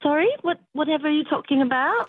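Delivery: innocently puzzled in put-on British; fake British accent. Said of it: "drop the British thing".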